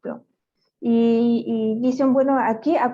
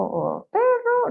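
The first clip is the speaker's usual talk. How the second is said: "perro" drawn out slowly, starting much higher and falling; high sweeping perro; 11 semitones higher, much slower than usual. Shown as a demonstration. Showing an interviewer a word with varied melody, drawn-out high falling tone. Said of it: "habla con tantas melodías".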